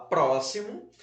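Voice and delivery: "próximo" stressed on the first syllable, PRÓ-xi-mo. Shown as a demonstration. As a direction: punch PRÓ in "próximo", offering it as an option. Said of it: "No pró". ("próximo" com força no PRÓ)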